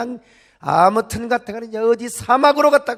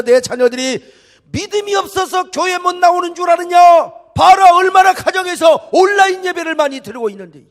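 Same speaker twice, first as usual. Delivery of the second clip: loud, high-pitched indignant shouting; preacher thundering as an outraged God; God's fierce rebuke; 7 semitones higher, 7 dB louder than usual. Acted. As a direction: roar at Satan in defence of your people. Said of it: "이렇게 책망해 주시기를 바랍니다".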